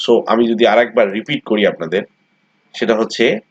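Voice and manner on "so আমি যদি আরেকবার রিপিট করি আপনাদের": ordinary talk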